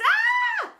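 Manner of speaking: very high, loud scream; children's scream. A narrator's reading. Kids screaming as slime hits, shrieking. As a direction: shriek in disgust.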